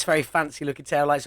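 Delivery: normal talking voice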